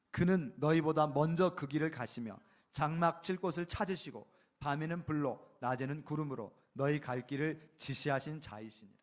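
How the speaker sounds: loud, slow solemn reading; scripture reading. Acted. Preacher reads Deuteronomy to the congregation, solemn and slow. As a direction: read the verse with weight.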